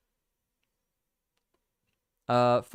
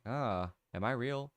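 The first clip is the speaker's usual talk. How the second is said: soft, wondering voice of a self-questioning brain; musing brain; 8 dB quieter than usual. Acted. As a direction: ponder it quietly, as if to yourself. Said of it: "the brain, like, thinks about itself".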